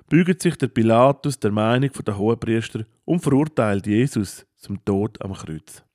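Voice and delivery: ordinary delivery, nothing marked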